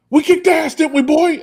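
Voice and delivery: very high, loud gleeful holler. Retold or imitated. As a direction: crow it into the kid's ear. Buddy's voicemail imitation.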